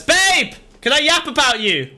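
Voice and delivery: high, loud call to a partner. Real, not acted. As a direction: holler a question to someone across the house. Calls out to partner mid-stream.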